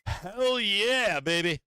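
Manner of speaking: a hammed-up gloat; gloating call seller. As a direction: play the smug seller celebrating. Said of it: "Call sellers are like".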